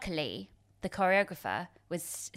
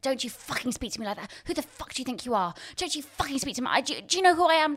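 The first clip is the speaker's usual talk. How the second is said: mock tirade, building higher and louder; imitated angry rampage; 6 semitones higher, 4 dB louder, faster than usual. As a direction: imitate a furious director, rising to an outburst. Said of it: "He went on this rampage".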